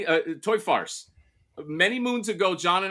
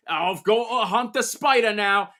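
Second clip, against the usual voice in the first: mock British kid; 6 semitones higher than usual. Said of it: "uh, British all of a sudden".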